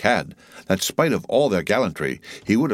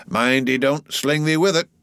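raised, urgent warning; warning cry; 7 semitones higher than usual. A narrator's reading. Starbuck warns Flask about the whale, warning cry. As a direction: call a warning, pitch lifted. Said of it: "cried Starbuck".

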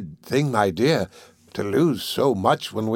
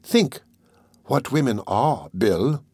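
ordinary delivery

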